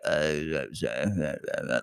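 low, soft throaty grunts; mid-throat voice. Retold or imitated. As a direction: mimic a throaty speaker with no projection. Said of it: "those people that speak, like, mid-throat".

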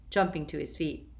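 ordinary delivery

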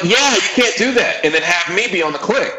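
high, laughing protest; amused incredulous retort; laughing disbelief. Retold or imitated. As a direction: tease the producer through a laugh. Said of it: "So I'm like".

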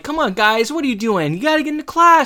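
a squeaky teacher shooing students into class; fussy teacher. Retold or imitated. Teacher voice, Mister Turner impression.

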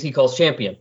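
ordinary delivery

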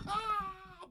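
high startled yelp; mock scream. Student startled by reserve opening, high yelp. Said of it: "They go".

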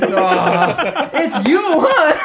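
high, loud, slow jeering taunt; mocking bully. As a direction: sneer it out, drawn out. Bully mocking the smelly kid.

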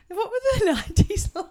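high, laughing mock question; laughing quote. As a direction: repeat the pupil's question through laughter. Repeats pupil's question while laughing.

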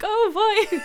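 put-on high, laughing oh boy. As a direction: laugh it out in disbelief. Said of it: "And I was like".

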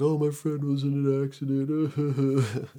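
lying to the waitress about why he's crying, put-on; fake excuse. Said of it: "So I'm like".

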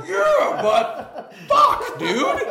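very high, loud squealing laugh. Retold or imitated. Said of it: "I was like".

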